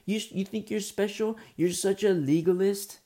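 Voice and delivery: high-pitched accusing jeer, little extra volume; mock yelling. Wicked people jeering at the believer, mock yelling. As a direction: pile up mock accusations, building to the last. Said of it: "they're yelling at you".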